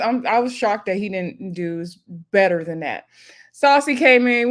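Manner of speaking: ordinary talk, nothing marked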